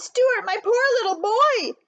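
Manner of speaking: high, slow pitying voice; anxious tender cry. A narrator's reading. Mother finding her freezing son, anxious and tender. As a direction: pity and worry for her boy.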